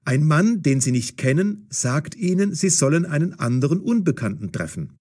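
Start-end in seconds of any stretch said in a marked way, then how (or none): none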